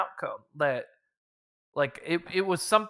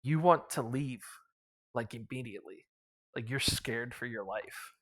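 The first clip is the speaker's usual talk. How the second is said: low hushed aside, much softer; leaning in, hushed; 5 semitones lower, 7 dB quieter than usual. Retold or imitated. GM leaning to the priest, telling him to flee: low hush. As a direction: murmur it close to the priest's ear. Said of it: "I just leaned to the priest".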